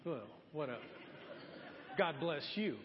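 low, subdued mock-grudging farewell; begrudging politeness, played for laughs; unsmiling greeting. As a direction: act out a grudging, unsmiling blessing. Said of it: "I'm not smiling at anybody".

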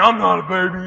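loud, defiant denial; acting tough. Friend answering his buddy's taunt, acting tough. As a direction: push back hard to save face. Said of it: "he tried to act all bad".

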